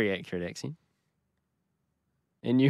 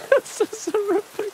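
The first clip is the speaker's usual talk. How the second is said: much higher, squeaky echo; mock echo. German cameraman mockingly echoing the horrified crier, in a silly high voice.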